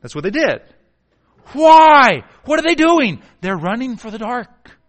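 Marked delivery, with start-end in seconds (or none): 1.5-4.8 loud, high preaching cry; full-force preaching. Acted. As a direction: call it out hard. Preacher shouting question.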